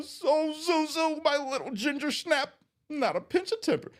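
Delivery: choked up, pushing words through crying; teary, cracking high voice; real crying. Real, not acted. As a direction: voice breaking high and strained as tears come. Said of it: "I cry".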